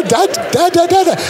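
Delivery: high, light "da, da" babble; baby babbling da. Shown as a demonstration. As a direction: babble "da" carelessly like a baby at play. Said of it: "voice dental consonant day".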